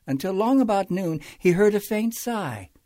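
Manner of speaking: ordinary delivery, nothing marked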